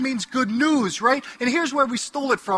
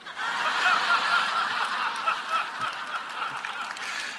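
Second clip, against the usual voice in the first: laughing; 6 dB quieter than usual. Real, not acted. Laughs at his own joke.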